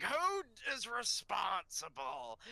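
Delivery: slow, deliberate principal voice; stern principal. Retold or imitated. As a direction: drag it out like a stern frog principal. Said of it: "Pixie Frog just being like".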